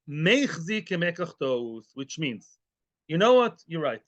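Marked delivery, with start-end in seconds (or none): 0.1-1.9 meichzi drawn out slowly, each syllable weighted; stress on meichzi. Shown as a demonstration. Teacher stressing meichzi for the class. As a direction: lean hard on meichzi, slow. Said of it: "stress the word".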